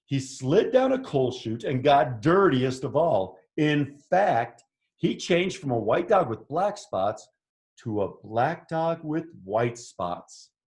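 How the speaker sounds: animated picture-book narration; story read to children. A narrator's reading. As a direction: read it out with lively swoops for young listeners. Reads picture book aloud.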